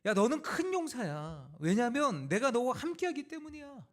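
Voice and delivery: high, impassioned sermon delivery; forceful preaching. Acted. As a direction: preach it with rising conviction.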